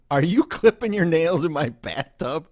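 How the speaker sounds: loud high mock-outraged question; incredulous yell. Host catching his guest clipping nails, loud disbelief. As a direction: shout it in disbelief. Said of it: "in that, in that tone".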